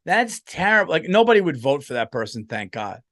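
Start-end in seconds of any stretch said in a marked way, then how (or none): 0.1-0.9 higher-pitched, drawn-out indignation; mock offended woman. Comic imitates a woman candidate taking offense, mock indignant and drawn out. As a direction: play an appalled woman, stretching the words. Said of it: "you can't have a woman like".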